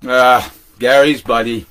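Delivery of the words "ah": usual speaking voice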